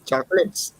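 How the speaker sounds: put-on a playful Indian accent on a movie quote. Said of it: "I should try it in the Indian accent".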